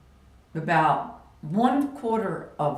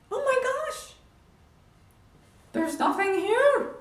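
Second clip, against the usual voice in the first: much higher, hushed wonder; amazed that it's suddenly over; astonished realization. Retold or imitated. Realizes opponent is gone.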